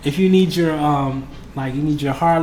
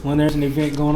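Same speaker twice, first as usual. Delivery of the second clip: ordinary talk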